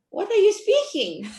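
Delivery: high, loud baffled question. Retold or imitated. Hearing the Terengganu dialect for the first time, loud and baffled. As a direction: retell her confusion at the dialect out loud. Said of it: "and I'm like".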